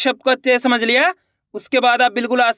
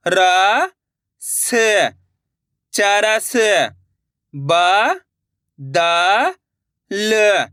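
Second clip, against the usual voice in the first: each letter sounded alone after a pause, then the word blended; steady classroom drill, letter by letter; drill reading; much slower than usual. Shown as a demonstration. Teacher leading students through a spelling drill, slow and clear.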